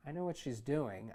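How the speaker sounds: plain reading voice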